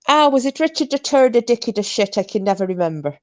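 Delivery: high Irish voice, teeth clamped shut; Irish accent through clenched teeth. Retold or imitated. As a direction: talk through closed teeth, Irish lilt. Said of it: "he'd go, Irish accent, teeth together".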